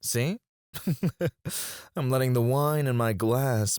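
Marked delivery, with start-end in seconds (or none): 0.7-1.9 chuckle. Acted. Boss pouring wine for assistant, chuckling. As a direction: chuckle lightly to yourself.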